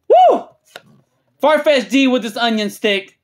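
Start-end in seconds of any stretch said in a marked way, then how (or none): 0.1-0.5 short, high, loud woo; hyped whoop. Real, not acted. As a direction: let out one piercing celebratory whoop. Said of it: "I'm getting hyped".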